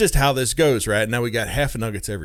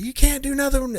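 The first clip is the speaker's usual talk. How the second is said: mock yelling mother; 13 semitones higher than usual. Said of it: "she starts yelling".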